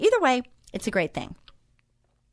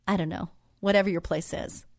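ordinary delivery

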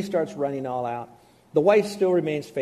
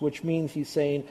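usual speaking voice

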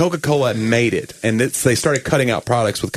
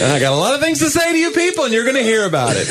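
high-pitched, ranting Festivus proclamation; airing of grievances; 12 semitones higher than usual. Acted. Comic pastor airing grievances to his people, high mock rant. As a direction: air your grievances in a raised, high voice. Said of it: "the airing of grievances at Festivus".

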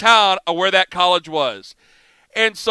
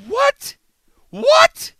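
much higher, shocked what, twice; stunned disbelief; 5 dB louder than usual. Retold or imitated. Host hearing the coach wants him fired, shocked disbelief. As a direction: squeak it out in total disbelief.

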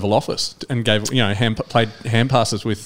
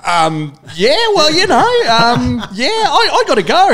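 very high, slow, flustered stalling, louder; panicked Brownless; 5 dB louder, slower than usual. Retold or imitated. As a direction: squirm out of the question, high and slow. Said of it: "And they went".

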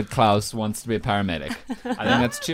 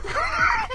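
high laugh. Real, not acted. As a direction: squeal of laughter. Laughing mid-appeal.

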